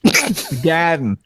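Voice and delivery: slow garden with the r dropped, gahden; mock Boston garden; repeating the Boston way. Shown as a demonstration. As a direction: echo the local gahden slowly. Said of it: "if you're a native, it's the garden".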